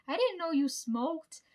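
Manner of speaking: high, loud, drawn-out disbelief; retold incredulous surprise. Grandchild retelling the reaction to Grandpa's smoking, loudly incredulous. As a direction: act out being astonished, stretching it out.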